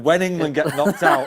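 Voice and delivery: quoting him while laughing; laughing through quote. Retold or imitated. Telling a friend's cheeky promise, laughing. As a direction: laugh out the punchline.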